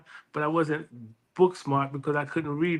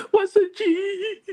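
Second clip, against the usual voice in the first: high, very slow drawn-out "What's a G?"; baffled repeat; 3 dB louder, much slower than usual. Retold or imitated. Son repeating mom's odd question, high slow bafflement. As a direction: stretch it out in total bewilderment. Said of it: "I said".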